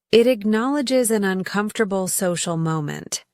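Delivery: ordinary talk, nothing marked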